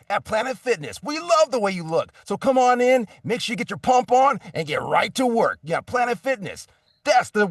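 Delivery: a voice actor mocking an over-the-top fitness ad, high and loud; hyped gym ad. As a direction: sell it like a cheesy gym spot on full blast. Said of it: "And then you have stuff like".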